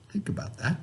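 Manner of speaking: soft whisper, nearly no voice; whispered prompt. Real, not acted. Teacher nudging students to ponder, whispered. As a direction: drop to a whisper to make them think.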